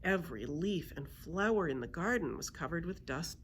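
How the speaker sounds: ordinary delivery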